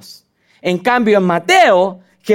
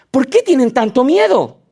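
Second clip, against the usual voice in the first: Jesus rebuking; 7 semitones higher than usual. Acted. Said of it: "Jesús les contestó".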